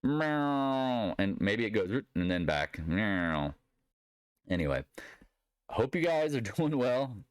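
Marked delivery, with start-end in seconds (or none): 0.0-1.1 high, long, sliding "meow" noise; imitated falling chart noise. Trader showing where the price will go, swooping noise. 2.9-3.5 drawn-out sliding "meow" noise; imitated chart noise. Trader showing the price going back, sliding noise.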